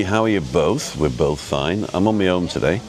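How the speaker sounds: usual speaking voice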